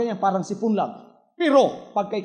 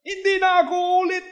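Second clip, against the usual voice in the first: mock vow; 10 semitones higher, faster than usual. Wife vowing never again.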